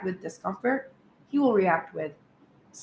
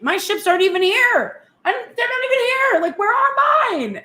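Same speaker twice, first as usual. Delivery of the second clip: much higher and louder, rising alarm; a frantic, high-pitched wail of worry, slowing at the end; panicked inner voice; 13 semitones higher, 5 dB louder than usual. Retold or imitated. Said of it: "you're starting to think".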